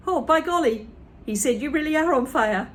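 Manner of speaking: surprised George; shocked boy. Acted. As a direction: gasp it out amazed.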